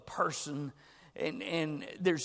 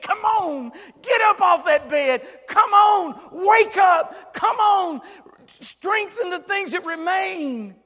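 high, loud pleading call; Jesus pleading loudly with a sleeping church; pleading shout; 11 semitones higher, 14 dB louder, slower than usual. Retold or imitated. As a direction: beg them to get up, full voice. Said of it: "angry and confrontational to, to pleading".

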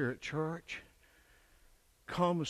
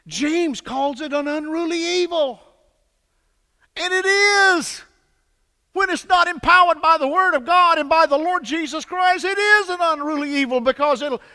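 full-throated revival preaching; preaching at full force; 13 semitones higher, 14 dB louder than usual. Acted. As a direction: drive it home from the pulpit.

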